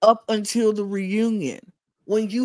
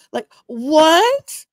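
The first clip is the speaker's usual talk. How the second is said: high, loud shocked what; floored by what he said; loud incredulous outburst; 10 semitones higher, 8 dB louder than usual. Real, not acted. Shocked reaction.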